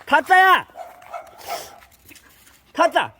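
loud call to the dog. Real, not acted. Owner calling his dog loudly. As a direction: call the dog's name across the yard.

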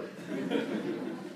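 breathy mocking wolf laugh; scornful laugh. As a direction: laugh off the ribbon.

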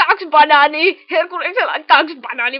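loud burst about two bananas; excited shout. Acted. Teasing a friend about bananas, loud excited shout. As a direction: blurt it out at full volume.

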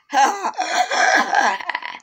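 villainous gloating laugh; evil laugh. Acted. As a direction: cackle over the victim.

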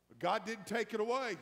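usual speaking voice

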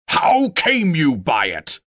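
slow, loud, furious question; rising rage. A narrator's reading. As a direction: shout it in gathering rage. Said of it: "shouted Thorin in gathering rage".

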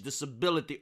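ordinary talk

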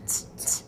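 crisp unvoiced T sound modeled. Shown as a demonstration. Teacher models the T sound for students. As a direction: tap out the T sound for kids. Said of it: "write the letter with the T sound".

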